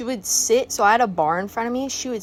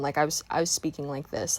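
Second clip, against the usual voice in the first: monotone; 4 semitones lower, 6 dB quieter than usual. Shown as a demonstration. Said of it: "a little more monotone".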